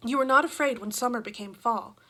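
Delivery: ordinary delivery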